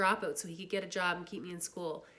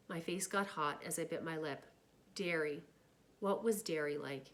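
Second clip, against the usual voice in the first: ordinary delivery, nothing marked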